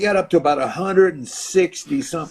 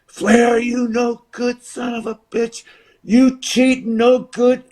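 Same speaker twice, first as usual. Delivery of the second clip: high mock yell of outrage; imitated heckling fan; 8 semitones higher, slower than usual. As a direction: heckle the wrestler from the stands. Crowd yelling abuse at Flair.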